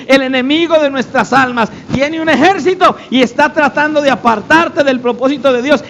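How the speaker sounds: ordinary talk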